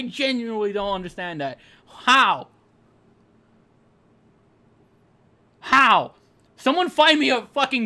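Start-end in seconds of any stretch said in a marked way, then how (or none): 1.9-6.1 louder exasperated how. Real, not acted. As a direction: demand an answer. Exasperated repeated question.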